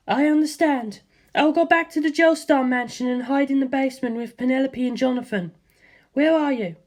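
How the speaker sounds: the mother agreeing calmly. A narrator's reading. Mother tells her son she will hide with the family, composed.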